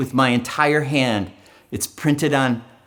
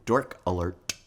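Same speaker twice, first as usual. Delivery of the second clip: unimpressed woman thinking; 8 semitones lower, 8 dB quieter than usual. Acted. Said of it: "in woman brain, says".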